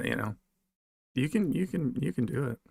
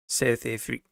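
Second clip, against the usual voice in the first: South African accent attempt; 4 dB quieter than usual. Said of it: "Trying to get that accent down".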